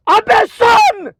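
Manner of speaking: high, long-drawn yell; angry shout. Real, not acted. Ordering opponents to listen, angry shout. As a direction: demand attention with a stretched, high shout.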